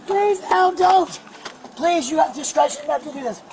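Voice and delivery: loud desperate begging; shouted pleading. Real, not acted. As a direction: yell your plea at the officer. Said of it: "You wanna scream at me some more?".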